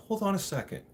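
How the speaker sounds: high, urgent cut-in; imitating himself cutting off the judge; alarmed interruption. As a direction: stop the judge mid-sentence.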